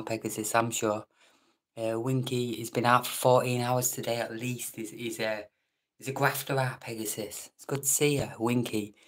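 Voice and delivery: ordinary delivery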